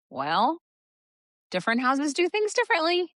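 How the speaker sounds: high, bright sing-song lilt; sing-songy. Shown as a demonstration. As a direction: sing it lightly to stay neutral. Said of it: "And I say it just like that".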